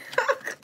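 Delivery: high squeaky laugh; high laughing shriek. Real, not acted. Laughing after shock.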